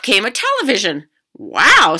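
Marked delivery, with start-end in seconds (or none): 1.3-2.0 put-on very loud wowed kid; amazed. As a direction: gasp in wonder. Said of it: "said Cheryl".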